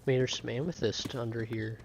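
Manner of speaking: normal talking voice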